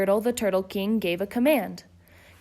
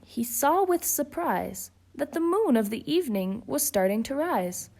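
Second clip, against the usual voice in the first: ordinary delivery, nothing marked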